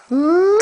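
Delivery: smack a big kiss, high; high kiss sound. Real, not acted. Father makes a kiss sound for baby.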